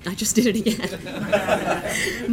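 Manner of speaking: slow, helpless real laughter in the words; laughing. Real, not acted. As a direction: crack up over your own slip. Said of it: "I'm just laughing because I had".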